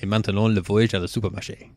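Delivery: French accent practice line. Shown as a demonstration. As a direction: keep the French accent going. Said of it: "warm up the French accent again".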